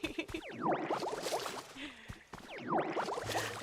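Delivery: long high giggle trailing off; high laugh. Real, not acted. Streamer admitting she smoked anyway, a high laugh. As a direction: laugh it out freely.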